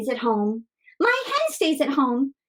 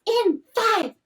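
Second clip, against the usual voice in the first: countdown building to shout; 13 semitones higher, 8 dB louder, much slower than usual. Shown as a demonstration. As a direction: count down getting louder and higher. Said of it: "So it goes like this".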